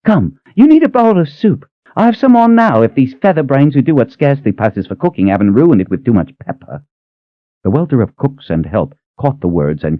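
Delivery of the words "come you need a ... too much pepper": put-on fussing old woman, mothering and grumbling; bossy old cook. Cook pulling Kaelin to soup while scolding cooks, high bossy fussing.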